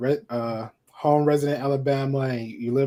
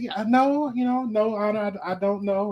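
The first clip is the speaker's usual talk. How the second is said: nervous high stammer; 6 semitones higher than usual. Retold or imitated. Stammering high answer to cop.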